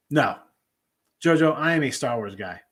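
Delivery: normal talking voice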